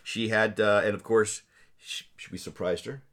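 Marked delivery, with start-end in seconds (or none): 1.8-3.0 hushed whisper. Real, not acted. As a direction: whisper it like a secret. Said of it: "Why are we whispering?".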